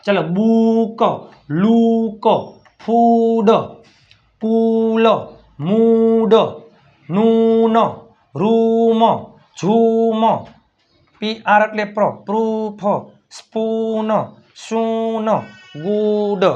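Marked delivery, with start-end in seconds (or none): 0.2-10.2 each word's oo stretched out, as in "બુક"; long oo. Shown as a demonstration. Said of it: "મોટો ઉ". 12.3-16.4 oo held long in "પ્રૂફ" and each word after. Shown as a demonstration. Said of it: "મોટો ઉ".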